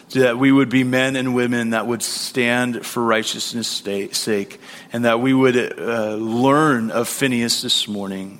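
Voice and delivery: earnest, low-pitched prayer voice; pulpit prayer. Acted. Pastor prays for the church's zeal, earnest and low. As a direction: pray sincerely over the church.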